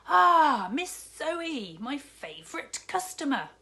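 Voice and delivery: high, loud, beaming welcome; delighted greeting. Acted. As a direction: greet her like your best customer. Shopkeeper greets favourite customer.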